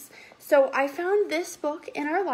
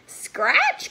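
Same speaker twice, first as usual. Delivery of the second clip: high, sudden call; mother cat's cry; 13 semitones higher, 4 dB louder than usual. A narrator's reading. Mother cat to her three kittens, crying out. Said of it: "cried the mother".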